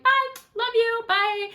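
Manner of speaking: high, slow, sing-song goodbye of a doting parent; loving parent waving goodbye. Retold or imitated. Parents waving at their grown kid, high sing-song goodbye. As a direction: call it out sweetly like a parent at the door. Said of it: "I just picture them being like".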